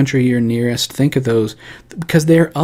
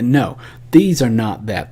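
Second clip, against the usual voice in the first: ordinary delivery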